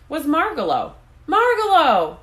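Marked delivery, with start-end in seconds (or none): 1.3-2.2 high, loud joyful cry of a name; cried out. A narrator's reading. Stuart crying out to Margalo, loud and glad. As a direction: call her name out in glad surprise. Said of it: "cried Stuart".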